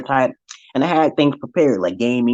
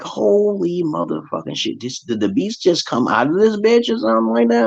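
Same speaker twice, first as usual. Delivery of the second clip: stunned disbelief; 9 semitones higher than usual. Retold or imitated. Shocked at the huge daughter.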